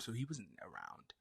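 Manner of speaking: ordinary talk, nothing marked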